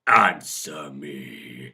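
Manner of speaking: low, loud, drawn-out command; forceful, menacing order; harsh demand. A narrator's reading. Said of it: "he demands, his voice harsh".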